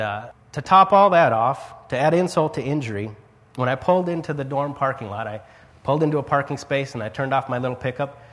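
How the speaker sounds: usual speaking voice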